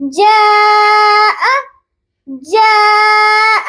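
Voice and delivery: جاء stretched slowly for four to five counts; long madd. Shown as a demonstration. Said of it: "four to five counts کھینچیں گے". (جاء کو چار سے پانچ حرکت تک کھینچیں)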